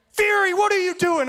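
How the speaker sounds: high, loud scolding shout; coach yelling. Retold or imitated. Coach calling out a player in warm-ups, scolding yell. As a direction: holler it like an angry coach. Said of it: "had to yell at me".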